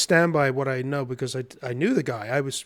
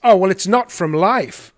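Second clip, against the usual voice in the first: loud, higher-pitched mock objection; objecting; 5 semitones higher, 8 dB louder than usual. Director jumps to her next objection at the artist, loud protest. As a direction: imitate her pouncing on a new objection.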